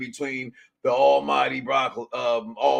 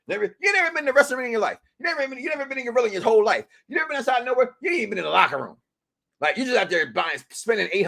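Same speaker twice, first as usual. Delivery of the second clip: heated rant; 7 semitones higher, much faster than usual. Real, not acted. Host rants at spoiler fans.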